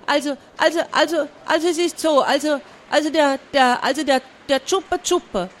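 flustered, stumbling over words; stammering boy. Acted. Embarrassed boy stammering to the pirates.

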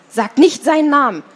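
high, loud piercing shout; shrill scream. A narrator's reading. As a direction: scream it so it cuts to the bone. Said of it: "schrie sie gellend".

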